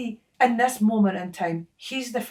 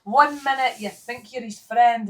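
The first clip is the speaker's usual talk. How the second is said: ordinary talk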